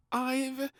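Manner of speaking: high, slow, nervous stammer; a high, halting excuse; stuttering. A narrator's reading. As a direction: back out nervously. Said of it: "he stuttered".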